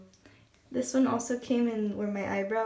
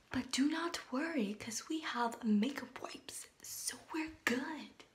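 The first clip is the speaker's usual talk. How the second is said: reassuring whisper; 2 semitones higher, 5 dB quieter, slower than usual. Real, not acted. Reassuring about smudged mascara.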